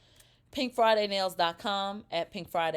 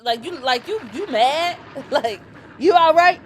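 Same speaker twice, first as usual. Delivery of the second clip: laughing tease; 7 semitones higher, 11 dB louder than usual. Real, not acted. Teasing Sheree's pressed reaction.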